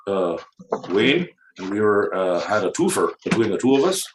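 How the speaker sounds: hammed-up Canadian accent. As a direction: tell the tale in a folksy mock Canadian accent. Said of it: "in a Canadian accent".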